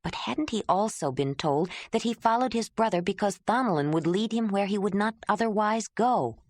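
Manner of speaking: usual speaking voice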